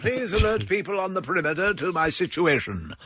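drowning Victorian gent with absurdly composed, proper English; hammed-up politeness while supposedly dying, pitched a little up; mock stiff-upper-lip victim. Drowning man politely addressing bystanders, mock stiff upper lip. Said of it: "Even while they're panicking, they have wonderful English".

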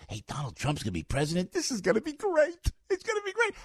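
high, excited cheer over the new president; gleeful prediction. Retold or imitated. Host recalling his own giddy cheer for Trump, squealed high. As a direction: squeal it like a thrilled fan. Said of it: "when I said".

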